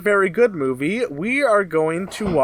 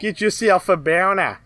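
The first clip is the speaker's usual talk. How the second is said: put-on hammy Aussie accent; a bad Australian accent. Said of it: "our terrible Australian accents".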